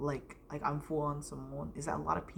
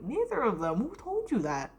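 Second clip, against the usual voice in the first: higher, louder mock indignation; retold indignant retort; 6 semitones higher, 5 dB louder than usual. Pupil correcting the teacher about the parents, indignant retold retort. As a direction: throw it back in disbelief, high and loud.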